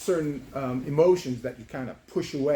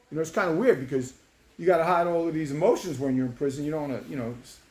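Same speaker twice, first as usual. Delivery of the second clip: plain reading voice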